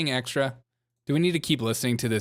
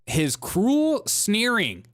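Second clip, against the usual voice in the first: mocking slow repeat; 6 semitones higher, much slower than usual. Mocking the guest's phrase.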